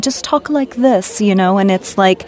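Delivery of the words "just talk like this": head-held-high Mid-Atlantic delivery, lifted in pitch; proper Mid-Atlantic accent. Shown as a demonstration. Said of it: "hold your head up high".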